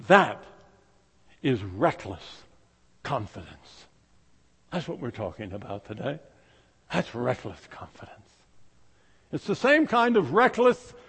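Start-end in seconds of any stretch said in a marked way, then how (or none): 0.1-6.2 low, soft, almost breathless; hushed. Real, not acted. Preacher hushing the congregation, soft and low. 6.9-8.3 softly whispered emphasis. Real, not acted. Preacher whispering the key phrase.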